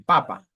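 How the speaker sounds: "papa" stressed falling, then light; fourth plus neutral tone. Shown as a demonstration. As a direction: punch the first syllable of "papa". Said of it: "Le quatrième ton plus le ton neutre".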